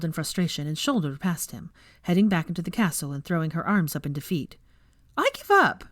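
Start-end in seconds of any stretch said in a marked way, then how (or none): 5.2-5.8 high, loud exasperated cry. Acted. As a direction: throw up your hands and shout it. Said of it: "she cried".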